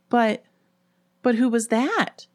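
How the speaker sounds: high, halting question; stammered. A narrator's reading. Ellen uneasy about the old woman, stammering. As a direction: stumble on the first word, then ask. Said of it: "she stammered".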